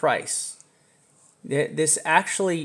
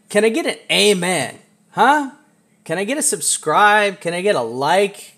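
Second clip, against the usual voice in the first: hype call; 6 semitones higher, 7 dB louder than usual. Real, not acted. Hyping chat for amens, likes.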